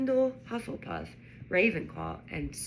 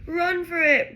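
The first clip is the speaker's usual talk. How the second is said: loud urgent shout, pitched up; 10 semitones higher, 8 dB louder than usual. A narrator's reading. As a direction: yell for them to run. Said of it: "Ron shouted".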